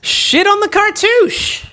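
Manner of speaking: high squeaky slow silly voice. Pretend. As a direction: squeak it out slowly. Patron credits in silly voices.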